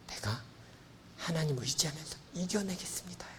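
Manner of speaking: soft whisper of trust; whispered vow. Acted. Believer quietly promising to lean on God, whispered.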